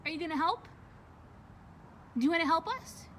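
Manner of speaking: ordinary delivery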